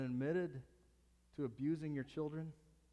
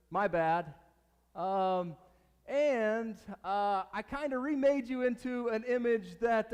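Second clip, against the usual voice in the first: loud, high, careless casual dude voice; bad acting; 7 semitones higher, 9 dB louder than usual. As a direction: swagger into the throne room like a buddy. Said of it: "I know I'm a horrible actor".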